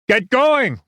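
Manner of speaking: high, raving officer's yell; loud, urgent battlefield order. A narrator's reading. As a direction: yell to get frozen men moving. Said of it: "Winters yelled".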